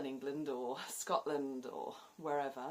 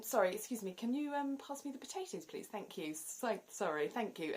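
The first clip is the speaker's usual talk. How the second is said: polite dinner ask; 6 semitones higher than usual. Retold or imitated. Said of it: "dinner party".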